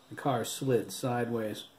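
ordinary delivery

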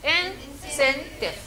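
incentive stretched out very slowly and softly; slow model word. Shown as a demonstration. Teacher repeats the English term for students, slowly. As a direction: say incentive slowly so the class can copy. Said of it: "إذا شو معنى حوافز".

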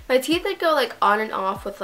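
ordinary talk

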